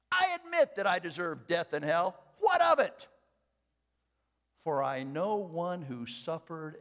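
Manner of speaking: quoting Luther with thunder; fiery preaching. Acted. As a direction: defy the devil out loud. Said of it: "And then he said".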